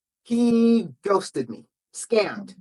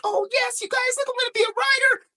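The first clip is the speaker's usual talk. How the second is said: much higher, fast, squealing delight; giddy thrill; 4 dB quieter, faster than usual. Retold or imitated. Author mocking her own giddy reply to the publisher, high and fast. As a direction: squeal it out like a dream come true. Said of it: "So I'm like".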